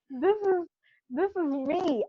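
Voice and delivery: high, excited voice easing down; elated declaration. Retold or imitated. Retelling how comfortable she feels at home, elated. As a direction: declare it joyfully, coming down from laughter.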